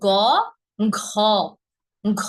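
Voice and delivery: ঘ as one aspirated syllable after গ; correct letter sounds. Shown as a demonstration. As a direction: say ঘ in one breathy burst, no separate হ. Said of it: "না, গহ না". (শুদ্ধ উচ্চারণে ঘ, এক ধ্বনিতে)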